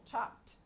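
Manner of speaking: "chopped" as one beat, chopt; one syllable. Shown as a demonstration. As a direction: leave the E silent in "chopped". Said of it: "pronounced like one syllable".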